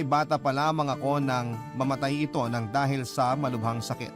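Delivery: normal talking voice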